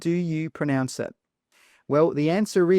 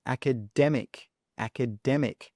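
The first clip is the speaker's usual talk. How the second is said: "academic" stressed on its third syllable, DEM; 3 dB quieter than usual. Shown as a demonstration. Teacher answers the question, stressing DEM. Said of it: "it's on the dem".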